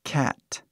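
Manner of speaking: Cat with an open short a; English vowel in cat. Shown as a demonstration. Teacher demonstrating Cat to Brazilian learners, clear open a. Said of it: "Vê o som, né?".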